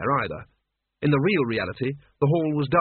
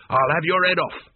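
louder, angry yell; furious threat; 4 semitones higher, 3 dB louder than usual. A narrator's reading. Mort threatens the Duke, angry shout. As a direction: threaten to cut his head off. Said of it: "he screamed".

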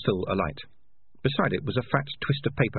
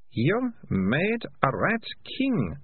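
very slow, high, weighted words; appalled realisation; 9 semitones higher, much slower than usual. A narrator's reading. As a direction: spell out the horror word by word, drawn out. Girl appalled at the confession.

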